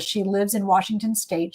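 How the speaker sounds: ordinary delivery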